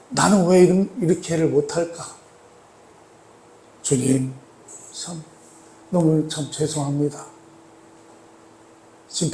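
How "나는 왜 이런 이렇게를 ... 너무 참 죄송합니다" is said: hushed, halting apology; contrite confession. Acted. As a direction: confess softly with heavy pauses, as if to the Lord. Acting a contrite prayer to the Lord. (죄송한 마음으로 나직이 고백하듯)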